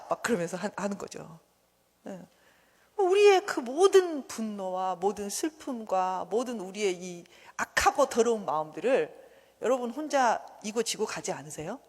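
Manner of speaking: ordinary delivery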